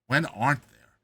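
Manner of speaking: ordinary delivery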